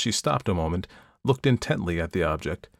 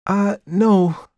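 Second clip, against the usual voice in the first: much higher and louder, spirited denial; 12 semitones higher, 7 dB louder than usual. A narrator's reading. Perdita answering her sister's jab, crying with spirit. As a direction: toss it back with lively spirit. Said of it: "cried Perdita with spirit".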